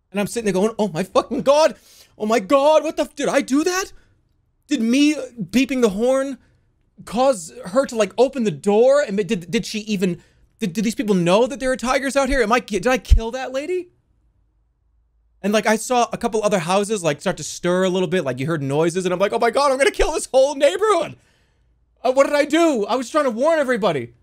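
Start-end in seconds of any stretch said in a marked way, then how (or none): 0.8-13.9 high-pitched, flustered panic; acted panic. Driver realizing the horn may have killed a lady, panicking. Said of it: "I'm panicking". 19.3-24.1 high, loud, panicked outburst; acted panic. Driver fearing the whole neighborhood will die, panicking. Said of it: "I'm panicking".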